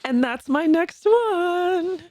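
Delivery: playful. Acted. Playful announcement.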